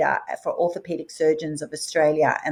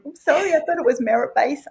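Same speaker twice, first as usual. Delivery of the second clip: raised, overly sweet apology; lightly voiced sweetie, a touch higher; sweet apologetic voice; 8 semitones higher, 3 dB louder than usual. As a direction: play the nice girl apologising. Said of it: "deep down I'm a real sweetie going".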